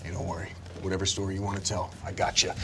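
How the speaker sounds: low, soft, quick reassurance; hushed and fast, dropping nearly to a whisper. Acted. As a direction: murmur it close, like a secret promise. Gunther reassuring quietly.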